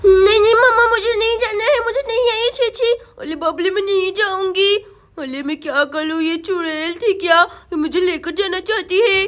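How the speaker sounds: put-on lisping baby-talk, scared and fretting; frightened little girl. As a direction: play a small child hiding in fear. Voices frightened Sonam. (डरी हुई तोतली बच्ची)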